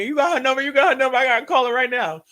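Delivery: loud, fast, high-pitched scramble; frantic urgency. Retold or imitated. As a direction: beg everyone in a rush. Acts out frantic hunt for number.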